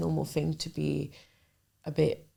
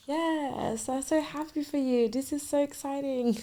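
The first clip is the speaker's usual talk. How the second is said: much higher, over-bright mock excitement; forced cheerful reaction; 9 semitones higher than usual. To her best friend moving to Spain, high fake delight. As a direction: act thrilled while clearly faking it. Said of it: "I think that was my reaction".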